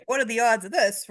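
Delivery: ordinary delivery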